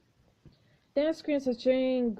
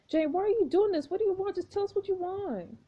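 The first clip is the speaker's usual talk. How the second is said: high pleading; 9 semitones higher than usual. Acted. Pleading with Jane.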